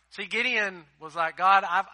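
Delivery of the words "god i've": loud plea. Retold or imitated. Gideon's excuse begins.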